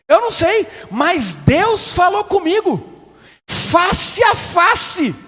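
high, loud, drawn-out testimony; imitating a woman preacher. Pastor imitating a woman who says God banned polka dots, fervent and high. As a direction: ham up a fervent sister's testimony. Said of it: "Ela falou assim".

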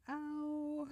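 high, drawn-out mock ow; long, understated cry of pain. Shrek as the arrow comes out, mock ow.

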